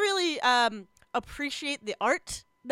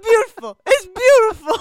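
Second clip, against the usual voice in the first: high, loud laughing praise; gushing through laughter; laughing delight; 9 semitones higher, 12 dB louder than usual. Real, not acted. Laughing rave over bloopers.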